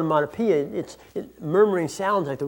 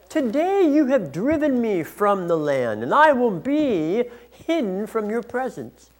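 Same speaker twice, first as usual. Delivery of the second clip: higher-pitched, emphatic grievance against God; Cain's complaint; 4 semitones higher than usual. Acted. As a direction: lament your punishment, pitch raised. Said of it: "the complaining that Cain does".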